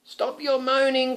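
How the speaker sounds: high, loud brave cry; cried out. Acted. Knight crying out at the moaning beasties, loud. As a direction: stand up to the beasties. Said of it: "cried the knight".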